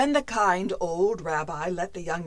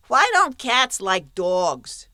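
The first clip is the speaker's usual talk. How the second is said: twins asking; 6 dB louder, slower than usual. Acted. Twins ask.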